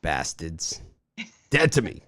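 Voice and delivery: put-on Boston accent. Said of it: "Is that a Boston accent coming out there".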